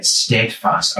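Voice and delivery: usual speaking voice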